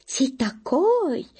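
put-on high, drawn-out German-accented surprise; a stern German poking a belly, amazed; удивлённо строго с немецким акцентом; surprised stern German. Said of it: "говорит удивлённо строго".

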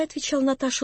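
ordinary delivery

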